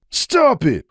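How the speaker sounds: sharp high yell. Acted. Hoops yelling at Sunburst to stop, sharp. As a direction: cut him off hard. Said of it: "Hoops yelled".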